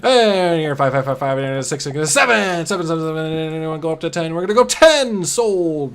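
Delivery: loud, rapid auction patter; auctioneer. Pretend. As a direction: rattle off bids like an auctioneer. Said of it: "I feel like an auctioneer right now".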